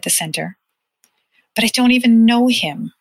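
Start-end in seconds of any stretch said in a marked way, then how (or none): 1.5-2.9 put-on higher, louder childish protest; objecting child. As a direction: push back against the news. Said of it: "he objected".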